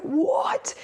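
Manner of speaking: high, breathy mock-shocked gasp of a word; hammed-up disbelief. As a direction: imitate a scandalised reaction, voice leaping up. Retold grandpa shocked at tattoo.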